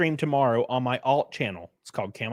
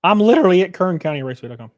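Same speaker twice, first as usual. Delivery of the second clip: excited; 5 semitones higher, 7 dB louder than usual. Real, not acted. Excited self-promo outburst.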